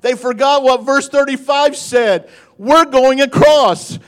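high, loud, drawn-out proclamation; full-force preaching. Real, not acted. Preacher tells of disciples in the storm, full-force preaching. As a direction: drive it home to the congregation.

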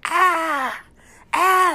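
high, loud storybook scream; two long, high shrieks. A narrator's reading. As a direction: shriek like a startled farmer's wife. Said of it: "screamed the farmer's wife".

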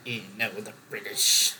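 low, soft mock grumble; imitated distaste. Quoting people who dislike the British, low mock distaste. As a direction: imitate people put off, pitched well down. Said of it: "people are like".

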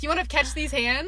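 tough challenge. Shown as a demonstration. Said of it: "It's like".

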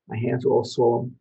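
ordinary talk, nothing marked

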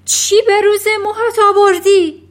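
high, very loud screech; panicked mother's piercing scream; horrified shriek. A narrator's reading. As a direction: shriek in horror at the sight. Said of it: "جیغ کشید".

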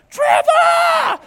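mom's high, piercing yell for her husband; drawn-out, hammed-up scream of horror; horrified shout. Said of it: "she, like, is horrified".

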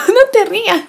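high, laughing protest. Real, not acted. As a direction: break into laughter mid-word. Laughing, telling friend not to laugh.

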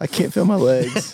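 lightly voiced groaning schoolboy; mock hurt kid. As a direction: ham up the kid who got kicked. Voicing kid kicked in nuts.

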